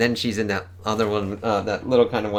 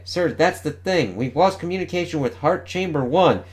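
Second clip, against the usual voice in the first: higher, urgent officer voice reporting damage; tense starship officer; 7 semitones higher than usual. Acted. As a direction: read it like a panicked bridge officer. Reads starship crew dialogue.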